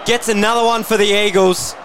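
erupting over a goal; long goal yell. Real, not acted. Goal kicked, commentator erupts.

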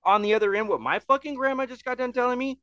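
telling a shocked shipmate what grandma said, high and incredulous; incredulous high retort. Retold or imitated. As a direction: shoot it back high and indignant. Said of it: "I was like".

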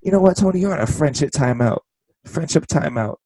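high, sassy mock scolding; imitating Britt Baker. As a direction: scold him in a high pouty voice. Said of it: "She goes like".

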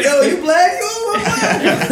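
loud, very high squeal through laughter; mock excitement. Pretending to bond with a stranger, loud mock excitement. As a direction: blurt it like a thrilling discovery, hammed-up. Acting out an absurd introduction, laughing.

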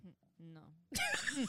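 usual speaking voice

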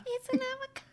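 put-on high teary kid voice; crying kid. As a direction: squeak it out like a sobbing kid. Imitating kid crying over avocado.

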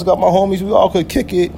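normal talking voice

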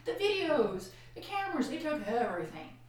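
high, loud, desperate railing; angry outburst at God. Retold or imitated. Wife shouting at God over her ruined home, high and loud. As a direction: cry out high and loud in despair. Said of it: "I was about ready to say".

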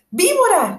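shrill high screech. A narrator's reading. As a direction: screech it like an alarmed bird. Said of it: "chilló la paloma".

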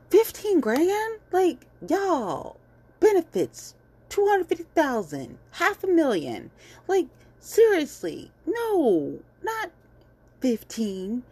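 high, loud incredulous outburst; exasperated high-pitched rant; outraged disbelief. Real, not acted. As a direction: shriek at how little it was. Outraged at the small settlement.

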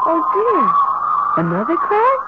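dismayed surprise. Acted. Wife seeing the wall crack reopen, alarmed surprise. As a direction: let the dismay jump up in pitch.

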